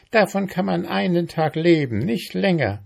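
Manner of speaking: high, indignant ant voice. A narrator's reading. Ant accusing mayfly of a swindle, indignant.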